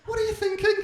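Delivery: high, loud expectant wife; the wife, prying; wife asking. Retold or imitated. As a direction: prod him for a romantic answer.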